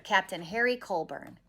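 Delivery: usual speaking voice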